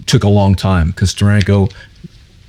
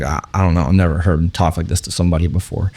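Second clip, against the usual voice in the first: lower, softer imitated bystander; handler's aside; 4 semitones lower, 5 dB quieter, faster than usual. Artist's handler confiding to a surprised fan, low soft aside. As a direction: drop the voice to a bemused aside. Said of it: "and he was like".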